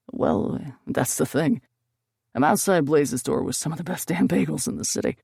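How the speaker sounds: a hint of a calm boyfriend; man's voice. Gabriel voiced by female narrator.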